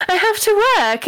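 imitating her own pleading to the doctor; mock whiny protest. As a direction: protest like a stubborn worker. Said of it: "I was like".

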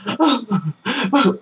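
high, hammed-up gasp of an over-acting scared actress; mock tearful gasp. Mimicking the actress wandering scared, hammy high gasp. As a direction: gasp like a ham playing scared. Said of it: "and she's going".

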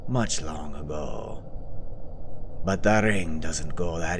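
slow, low, half-whispered Scottish brogue; weary old Scottish villain, slowly; gruff Scottish lord. Acted.

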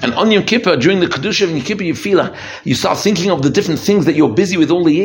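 ordinary delivery

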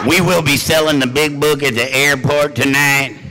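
loud booming announcer voice; mock announcement. Speaker teasing newcomers with a mock PA announcement, loud. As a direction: boom it out like a PA announcement.

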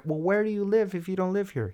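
high-pitched retold lady's voice; imitated lady questioning. Lady asking the boy where he lives, high imitated voice. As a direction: pitch it high, mimicking a coaxing bystander.

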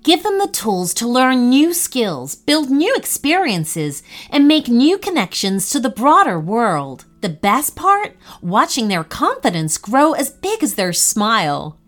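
upbeat, emphatic sponsor read. Acted. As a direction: sell it to parents with punchy emphasis. Reads a sponsor advert.

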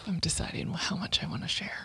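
breathy whispered hesitation, then a soft laugh; hesitant whisper. Real, not acted. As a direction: murmur it to yourself, unsure whether to share. Hesitating before an intimate confession.

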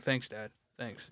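sarcastic thanks. Retold or imitated. Answering his dad's told-you-so, soft sarcastic thanks. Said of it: "I'm like".

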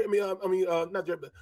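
usual speaking voice

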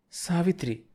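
struggling to find the voice; forcing out words. A narrator's reading. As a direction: force your name out of a stuck throat. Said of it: "గొంతు పెకిలించుకొని చెప్పింది".

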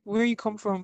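squeaky high mimic of curious strangers; high-pitched teasing impression of questioners; mocking high voice. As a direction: pitch way up, playful imitation. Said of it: "They were like".

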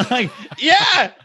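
high voice through real laughter; laughing. Real, not acted. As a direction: laugh the words out. Laughing at his excuse.

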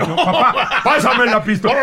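alarmed son. Retold or imitated. Son pleading with his armed father, alarmed. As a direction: beg the father urgently.

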